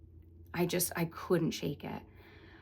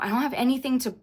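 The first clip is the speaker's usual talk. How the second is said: higher and louder, rising panic; panicking on the plane with no gum or candy, higher and louder; freaking out; 5 semitones higher, 6 dB louder than usual. Retold or imitated. Retelling a panic attack on the plane, frantic. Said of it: "like, freaking out".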